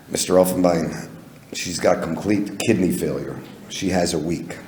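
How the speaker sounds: low, soft voice of a vet breaking bad news; grave doctor. Doctor tells owner of kidney failure, grave and hushed. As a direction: quietly deliver the terrible news.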